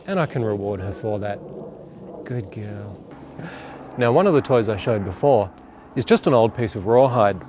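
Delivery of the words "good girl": much softer, almost murmured praise; calm praise. Shown as a demonstration. Trainer rewarding Lizzie the dog for sitting, soft calm praise. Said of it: "change the tone of my voice to being nice and calm".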